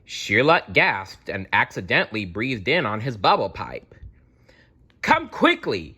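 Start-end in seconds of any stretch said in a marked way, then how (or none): 5.0-6.0 high-pitched Scottish constable calling out; a much higher Scottish voice, urgent; Scottish shout. A narrator's reading. Constable urging the detectives to hurry, in a high Scottish voice. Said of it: "shouted Scooter, the Scottish Carrot Constable".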